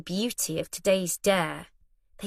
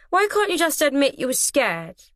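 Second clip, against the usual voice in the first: pressing; 7 semitones higher, 4 dB louder than usual. A narrator's reading. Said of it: "Finn pressed".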